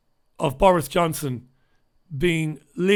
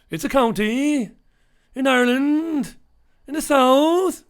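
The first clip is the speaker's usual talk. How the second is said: lilting Cork sing-song climbing high and louder; Cork accent; 9 semitones higher, 4 dB louder than usual. Retold or imitated. Explaining Cork to an ignoramus in a Cork lilt. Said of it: "where people talk like that".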